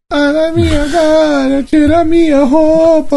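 high, slow put-on whine; hammed-up complaining voice; mock whiny woman. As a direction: whine it like the woman being quoted.

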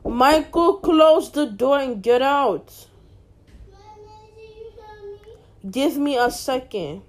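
loud, slow, furious order to get out; angry shout. Real, not acted. Brother still in the room.

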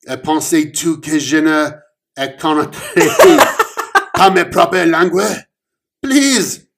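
enraged cop scolding Omen in French; angry French rant. Acted. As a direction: rant in French through laughter.

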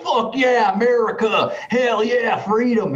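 mock American fan cheering Fuck yeah; cheering American dudes. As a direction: whoop it up like stoked patriotic guys in Vegas. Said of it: "They're like".

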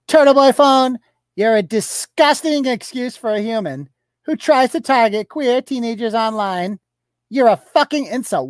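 high mock-outraged voice of the poster; mocking tweet read. Blogger reads out her insult against himself, mock-outraged. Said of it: "She writes".

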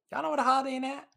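high silly voice; guy's voice. Retold or imitated. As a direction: pitch it way up. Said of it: "She was just biking and then".

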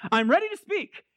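high, sudden declaration; mock grand announcement pitched high; announcing himself. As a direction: burst out ready to talk. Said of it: "all of a sudden one day".

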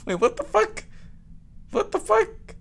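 high, laughing disbelief; surprised. Real, not acted. Shocked Austria is on the list, high disbelief. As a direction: squeal it in shock.

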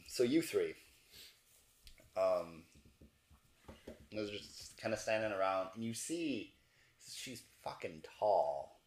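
ordinary talk, nothing marked

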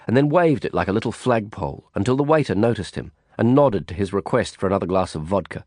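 plain reading voice

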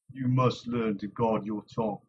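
plain reading voice

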